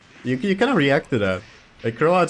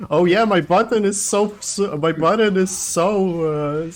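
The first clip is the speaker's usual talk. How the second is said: high, slow hammed-up grumble; exaggerated, labored lament; mock complaint; 7 semitones higher, 3 dB louder, slower than usual. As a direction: draw out a put-on complaint about the button. Parodies a complaining player.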